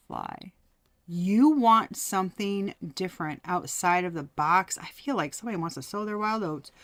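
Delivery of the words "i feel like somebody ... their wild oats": ordinary talk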